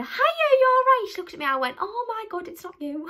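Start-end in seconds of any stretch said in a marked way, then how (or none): 0.0-1.1 high, loud calling-out greeting; shouted greeting. Retold or imitated. Calling to a stranger at the bus stop, loud high greeting. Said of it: "So I shouted to her". 1.8-3.1 surprised. Retold or imitated. Realising the stranger is wrong.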